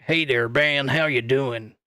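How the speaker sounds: a light southern US twang on a friendly greeting; southern twang impression. Streamer imitating Bruce's southern twang to chat, lightly voiced. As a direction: drawl the hello like a Wyoming rancher, lightly. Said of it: "Sort of like that".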